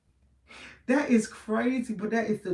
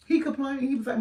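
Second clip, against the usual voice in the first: ordinary delivery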